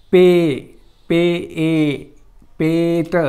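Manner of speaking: पे, पे ए, then पेट, vowel held; sound by sound. Shown as a demonstration. Teacher building the word for students, पे ए into पेट, deliberately. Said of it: "असा येतो मित्रांनो".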